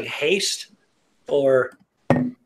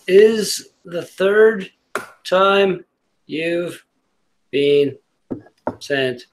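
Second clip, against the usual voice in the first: word by word with long pauses; deliberately drawn-out, stern-sounding card reading; slow, halting delivery; much slower than usual. Acted. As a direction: drag it out, a beat after each word. Same card reread word by word.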